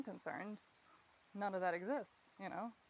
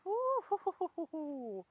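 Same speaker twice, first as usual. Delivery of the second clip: high, slow hooting woo-hoo; mock whoop; 8 semitones higher, 4 dB louder, slower than usual. Mocking the idea of open emotions, slow high woo-hoo. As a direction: hoot it out like a sarcastic cheer.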